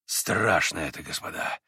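low voice dropping to a whisper on господа; shuddering horror. A narrator's reading. Mitya hearing how his father died, horrified whisper. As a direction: shiver as the horror lands. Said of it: "вздрогнул вдруг Митя".

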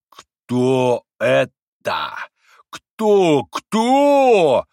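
slow, stretched question climbing to a high shout; outraged, escalating bellow; boiling over. A narrator's reading. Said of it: "вдруг закипятился ужасно доктор".

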